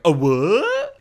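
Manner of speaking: loud rising mock grunt of surprise; imitated creature double take, swooping up; startled zombie reaction. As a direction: react like a zombie caught off guard. Zombies react to being noticed.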